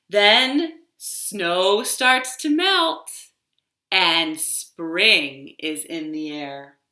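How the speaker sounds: a tree telling its seasons, slow and expressive; tree character. Acted. Librarian reading a picture story to toddlers, storytelling voice. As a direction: read it as the tree, savouring each season.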